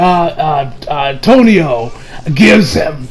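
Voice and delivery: ordinary talk, nothing marked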